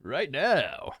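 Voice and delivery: very slow, dramatic announcer read. Acted. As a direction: drag it out like a cliffhanger. Story narration ending.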